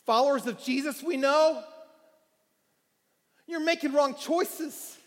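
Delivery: pulpit-pounding exhortation; fiery preaching. Acted. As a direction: plead with the lost at full force. Preaching climax.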